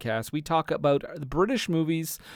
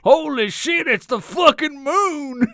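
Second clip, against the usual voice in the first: mock excited astronaut; 11 semitones higher, 7 dB louder, slower than usual. Astronaut exclaiming on moon.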